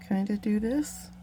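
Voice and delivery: normal talking voice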